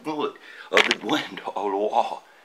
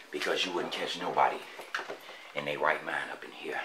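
whisper; 4 semitones lower, 12 dB quieter than usual. Real, not acted. Sneaking through abandoned Detroit building.

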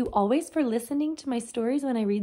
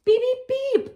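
high, loud giggly beeping; laughing beeps; 14 semitones higher, 6 dB louder than usual. Acted. Clean taxi laughs in the car wash, high joyful beeps. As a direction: beep with delight like a happy little car. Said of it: "laughed Max".

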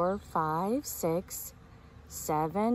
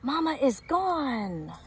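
lightly voiced little chick, higher; a small chick in a hurry, pitched higher; Little Chick's voice; 6 semitones higher than usual. A narrator's reading. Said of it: "says Little Chick".

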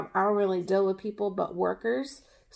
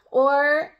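ordinary delivery

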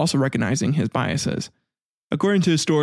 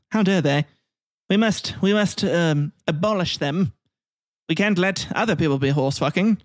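a hammed-up, higher English accent, scandalised; mock British elite; 5 semitones higher than usual. English readers sneering at the Irish, in a mock posh English accent. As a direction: play the pompous English elite in a bad English accent. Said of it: "They were like".

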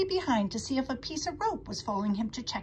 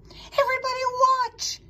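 high, loud call to onlookers; excited cry; 7 dB louder than usual. A narrator's reading. Pig on the manure pile before jumping, crying out. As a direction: cry out high for all to hear. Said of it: "he cried".

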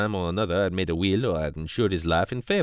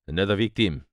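ordinary delivery

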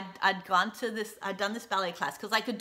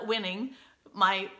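usual speaking voice

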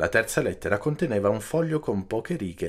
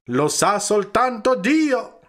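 loud, high, slow exclamation; impassioned cry; 12 semitones higher, 5 dB louder, slower than usual. A narrator's reading. Don Camillo answering about the man lost at sea, impassioned cry. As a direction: cry it out, spacing the words heavily. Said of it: "gridò don Camillo".

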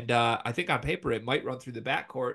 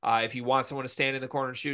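normal talking voice

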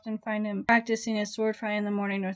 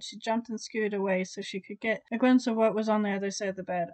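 ordinary delivery

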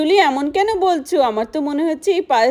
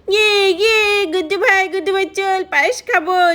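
put-on excited child voice; 6 semitones higher than usual. Child voice excited about payesh.

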